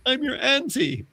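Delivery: very high-pitched auntie voice; visiting auntie. Retold or imitated. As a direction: squeak it as the surprise relative. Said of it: "Imagine having a relative turn up".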